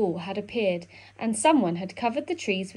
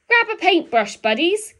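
higher, louder, cheery call; a bright higher call to his buddies; 10 semitones higher, 5 dB louder than usual. A narrator's reading. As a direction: call the animals over to paint. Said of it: "called Badger".

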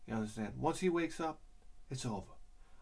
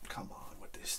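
whisper; 13 dB quieter than usual. Real, not acted. Whispered while waiting.

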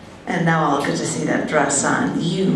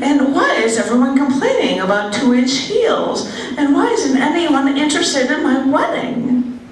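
higher, lightly mocking complaint; imitating the bride friend; 7 semitones higher than usual. Mimicking the frazzled bride friend's complaints, higher. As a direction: whine it a touch, pitched up.

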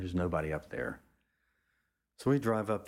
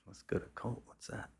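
softer, "Colt?" rising in disbelief; puzzled question; 3 semitones higher, 8 dB quieter than usual. Retold or imitated. Narrator repeats Charlie's idea, softly puzzled and rising. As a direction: echo the name back, baffled and quiet. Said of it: "I'm like".

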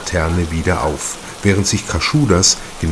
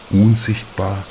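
usual speaking voice

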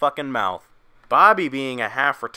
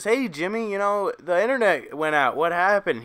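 much higher, sweet motherly put-on voice; mom voice; 7 semitones higher than usual. Mom asking her son why the internet died, high mock mom voice. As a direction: play the puzzled mom checking on her son. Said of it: "and she says".

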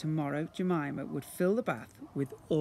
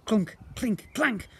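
clattering jar sounds; 6 semitones higher, 5 dB louder, slower than usual. A narrator's reading. Jars toppling, sound effects.

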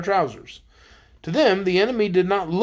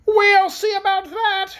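high, slow, menacing retort; angry queen; 4 dB louder, slower than usual. A narrator's reading. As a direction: retort angrily, drawing it out up high. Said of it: "retorted the Queen angrily".

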